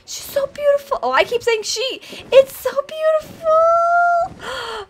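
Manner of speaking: very high, cooing squeal; squeaky, overcome delight; adoring gushing. Real, not acted. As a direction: gush and stretch the last word. Gushing over the baby dragon.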